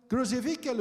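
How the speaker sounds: ordinary delivery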